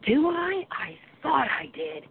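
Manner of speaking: high, wondering question, then slowing right down; astonished, drawing out the words; surprised realization. Retold or imitated. Client learns of house spirits, surprised.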